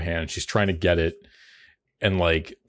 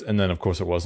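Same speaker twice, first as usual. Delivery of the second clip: usual speaking voice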